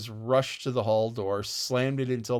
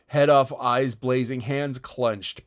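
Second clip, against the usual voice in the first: usual speaking voice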